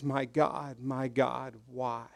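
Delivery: almost breathe the words; quiet, lost plea; hushed anguish. Retold or imitated. Jesus realizes God turned away.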